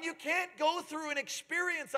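ordinary delivery

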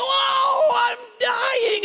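high, drawn-out hammed-up death wail; mock pain. Father playing the dying lie for daughter, mock pained wail. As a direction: play-act dying in agony, very high. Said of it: "the fact that I was in pain".

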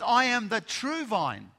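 slow, loud, sure declaration; Jesus declaring to his people, bold and confident. Acted. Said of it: "says very boldly and very confidently".

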